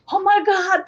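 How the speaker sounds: high, re-enacted astonished gasp of words; delighted amazement. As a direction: recall it with high-pitched wonder. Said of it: "I'd go".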